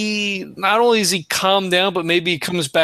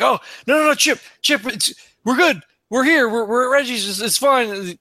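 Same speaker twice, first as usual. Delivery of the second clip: reassuring; 5 semitones higher than usual. Acted. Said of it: "He's like".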